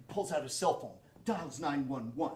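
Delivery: ordinary talk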